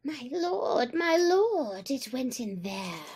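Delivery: a touch of a squeaky toady; squeaking. A narrator's reading. Tabaqui to Shere Khan at the cave, mildly squeaky. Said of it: "Tabaqui behind him was squeaking".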